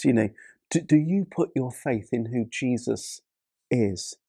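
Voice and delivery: ordinary talk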